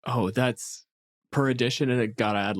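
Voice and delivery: usual speaking voice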